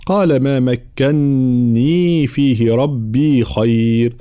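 slow, drawn-out tajweed recitation; Quran recitation. Shown as a demonstration. Teacher reciting the next verse, slow tajweed recitation. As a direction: chant the verse with long vowels and held nasals.

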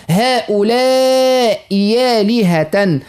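"هؤلاء آلهة" with ya in place of hamza, slowly; slow recitation demo. Shown as a demonstration. Teacher reads the example for listeners, slowly. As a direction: glide "هؤلاء" into "آلهة" as "يالهة". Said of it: "بدلنا الهمزة الثانية يا خالصة".

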